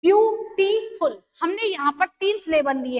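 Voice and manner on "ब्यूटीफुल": ब्यूटीफुल said slowly, syllable by syllable; teacher spelling out ब्यूटीफुल in slow beats; slow syllable demo. Shown as a demonstration. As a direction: stretch ब्यूटीफुल out to show its three syllables. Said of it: "आप देख सकते हैं कि इसमें कितने syllable थे".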